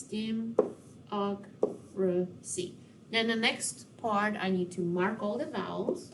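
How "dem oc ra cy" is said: "Dem-oc-ra-cy" softly, in four slow separate pieces; split into syllables. Shown as a demonstration. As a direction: quietly space out each syllable of "Dem-oc-ra-cy". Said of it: "we're going to split it".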